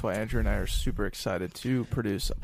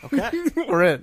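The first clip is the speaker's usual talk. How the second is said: laughing; 10 semitones higher, 4 dB louder than usual. Real, not acted. Laughing agreement to Puerto Vallarta.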